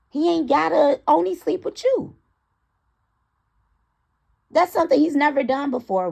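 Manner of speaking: high, loud exasperated outburst; ranting high and loud. Real, not acted. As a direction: throw it at them, fed up. Heated rant to the audience.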